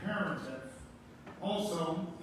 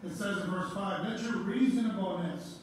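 raised, ringing pulpit voice; fervent preaching; 4 semitones higher, 3 dB louder than usual. Acted. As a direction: preach it out to the congregation, building. Preaching climax, exhorting congregation.

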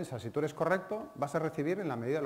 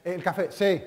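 curt waiter; 5 semitones higher, 9 dB louder, slower than usual. Retold or imitated. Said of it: "waiter barks back".

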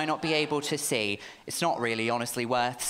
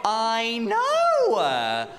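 excited shout; 8 semitones higher, 8 dB louder than usual. Real, not acted. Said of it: "shouted joy".

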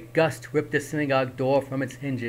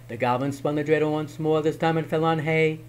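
usual speaking voice

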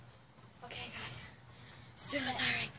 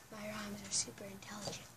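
whisper; 5 semitones lower, 6 dB quieter than usual. Real, not acted. Whispered aside about rhymes.

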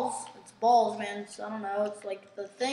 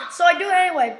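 ordinary talk, nothing marked

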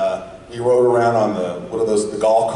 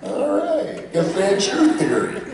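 Chris Farley; 10 semitones higher, slower than usual. Retold or imitated. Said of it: "And Farley looks over at me and goes".

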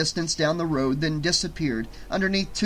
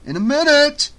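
much higher, loud, frantic reply; desperate shout; 12 semitones higher, 9 dB louder than usual. A narrator's reading. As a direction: shout back while running for your life. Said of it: "shouted Eric desperately".

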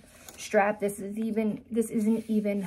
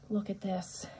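hushed; 9 dB quieter than usual. Real, not acted. Unveiling Valentine's gift bag.

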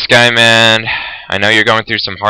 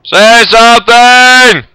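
high, loud, drawn-out yell; shouting demand; 11 semitones higher, 5 dB louder, much slower than usual. Real, not acted. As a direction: bellow it slowly at him. Yelling at silent companion.

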